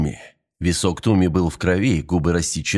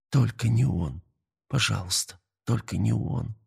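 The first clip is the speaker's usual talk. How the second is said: soft, small begging voice; hushed pleading; 6 dB quieter than usual. Acted. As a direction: beg quietly, almost to yourself. Мария молит за друга.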